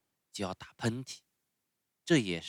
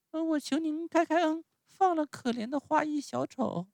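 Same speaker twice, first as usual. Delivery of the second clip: put-on very high puppet-child voice; pleading Pinocchio. Pinocchio begs mercy for the clown, high pleading. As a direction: beg earnestly in a small piping voice.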